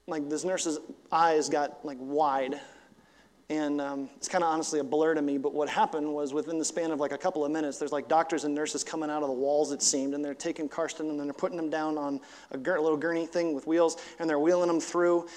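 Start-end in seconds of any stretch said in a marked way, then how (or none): none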